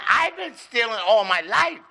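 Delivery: high-pitched wife voice talking back; hammed-up defiant wife. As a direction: argue back high and unbothered. Acted wife answering back in joke.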